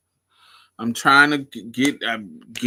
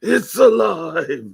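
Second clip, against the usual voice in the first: slow, higher, loud shout; mad scientist; 8 semitones higher, 8 dB louder, much slower than usual. Retold or imitated. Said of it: "They used to give out honey".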